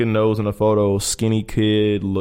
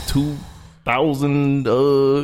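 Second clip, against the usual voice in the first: slow, halting, higher-pitched mock stumble; stumbling; 6 semitones higher, much slower than usual. Bouncer imitates fake-ID holders stuck on their birthday, stumbling.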